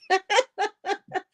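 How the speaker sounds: high-pitched amused laughter; real laugh. Real, not acted. Amused by the viewer's phrase.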